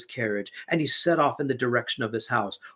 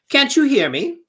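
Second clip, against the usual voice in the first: much higher and louder shout at a deaf woman; 13 semitones higher, 10 dB louder than usual. A narrator's reading. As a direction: bellow it up high so she must hear. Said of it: "he shouted".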